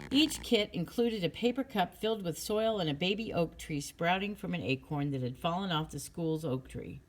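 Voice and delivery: usual speaking voice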